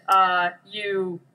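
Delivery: ordinary talk, nothing marked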